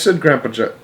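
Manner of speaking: plain reading voice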